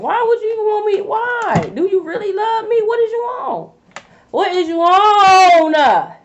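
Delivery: high, disbelieving questions climbing to a shout; incredulous. Acted. Asking an obsessive lover, incredulous and rising to a shout.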